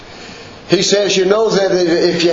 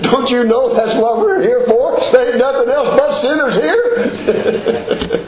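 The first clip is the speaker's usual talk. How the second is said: amused, pitch raised, laughing through the words; laughing retort; 6 semitones higher than usual. Retold or imitated. Preacher answering his father-in-law, laughing. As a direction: tease him fondly while laughing.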